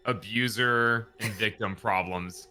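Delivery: ordinary talk, nothing marked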